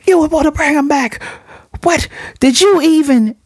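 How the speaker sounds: high-pitched, indignant retold outburst; going off. She confronts him about bringing him back, imitated high-pitched outburst. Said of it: "she goes off at first".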